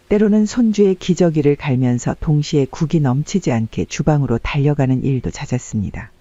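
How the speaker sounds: ordinary delivery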